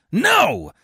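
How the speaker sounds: high, loud refusal; defiant shout. A narrator's reading. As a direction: refuse with sword drawn. Coriolanus refuses, draws sword.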